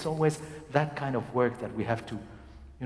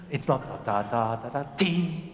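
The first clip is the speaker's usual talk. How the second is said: normal talking voice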